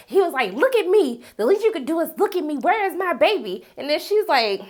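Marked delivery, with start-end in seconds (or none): 0.5-3.7 high, mildly raised mock yell; imitated angry yelling. Livid commander demanding his baby, imitated high-pitched yelling. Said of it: "He yelling, he's screaming".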